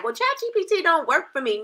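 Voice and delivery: high-pitched mimicked whiner; mock complaint. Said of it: "you're gonna be looking at it like".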